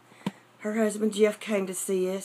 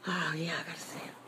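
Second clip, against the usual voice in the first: soft breathy whisper so the babies stay asleep; hushed, unvoiced, barely there; 2 semitones lower, 9 dB quieter than usual. Real, not acted. Whispering in the nursery so the sleeping babies aren't woken.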